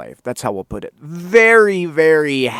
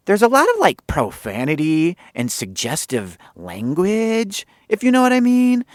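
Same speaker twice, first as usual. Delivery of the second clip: higher, careful producer voice; mock tactful producer, much higher on the end; tactful Benji; 4 semitones higher, 3 dB louder than usual. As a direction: break it to him gently. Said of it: "idea number one".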